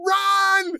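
high yelled run; dad yelling to son as bear roars, high panicked shout. Retold or imitated. Sudden high frantic yell. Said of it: "And I went".

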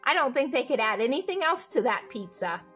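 ordinary delivery

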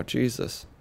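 ordinary delivery

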